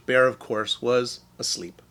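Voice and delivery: ordinary delivery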